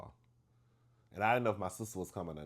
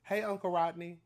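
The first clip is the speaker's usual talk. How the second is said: put-on high, bright, carefree kid voice; cheery little niece; 9 semitones higher, 4 dB louder than usual. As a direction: chirp it like a child with no idea she's in trouble. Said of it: "Miss Tatum".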